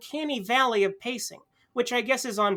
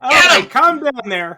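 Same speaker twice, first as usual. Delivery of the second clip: loud retort; 4 semitones higher, 14 dB louder, slower than usual. Acted. Quarrel over objection.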